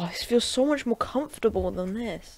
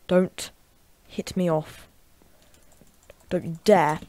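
ordinary talk

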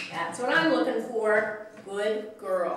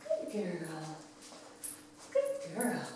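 normal talking voice

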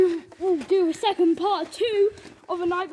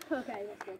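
hushed; 9 semitones lower, 12 dB quieter than usual. Pretend. Hiding on patrol.

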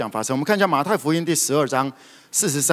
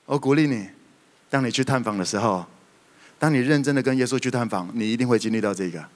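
usual speaking voice

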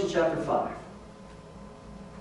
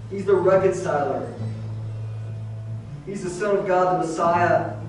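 steady, reverent prayer voice; closing prayer; 2 semitones lower, 3 dB quieter than usual. Acted. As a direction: pastor leading the congregation in prayer.

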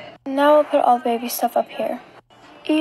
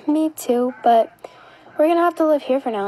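usual speaking voice

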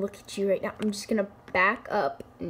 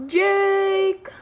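drawn-out call, sighing; 8 semitones higher, 4 dB louder than usual. Real, not acted. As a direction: call him from across the house. Calling out to brother.

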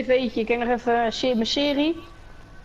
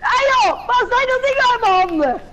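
much higher and louder, dazed nephew; high stoned voice; 11 semitones higher, 5 dB louder than usual. Acted. Nephew high on grass, staring at his hands, squealing loud and high. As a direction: freak out at your own hands, high and loud.

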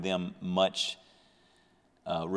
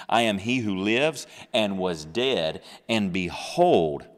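louder, slow proclaiming; preaching proclamation; 6 dB louder, slower than usual. Acted. Preacher reading Jesus's words, slow and louder. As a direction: stretch out "behold" for weight.

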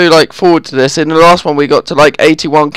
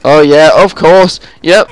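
ordinary delivery

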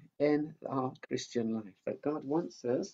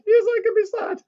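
much higher squeaky puppet; 13 dB louder than usual. Acted. Mickey the puppet cutting in, high-pitched. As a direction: make the puppet pipe up.

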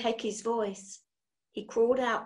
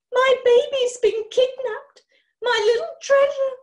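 much higher and louder, a crying screech; 8 dB louder than usual. A narrator's reading. Frantic mother screeches over her missing baby, crying. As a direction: wail it out in a hysterical screech. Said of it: "screeched Missus".

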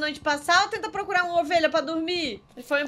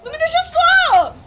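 high frantic yelling; panicked calling; 8 semitones higher, 10 dB louder, slower than usual. Real, not acted. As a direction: call out in a panic.

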